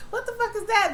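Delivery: high startled what-the-hell; shocked. Real, not acted. Viewer hearing strange woods noise, high startled outburst. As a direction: jump up in pitch, spooked.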